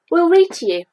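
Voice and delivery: brightly offering help; cheerful. A narrator's reading. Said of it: "said Rabbit cheerfully".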